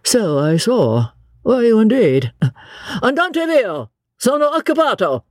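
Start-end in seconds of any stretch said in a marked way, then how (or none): 3.0-5.2 high-pitched Italian brush-off; curt dismissal. Acted. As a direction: shoo off a pestering vendor. Chaplain shoos away vendor.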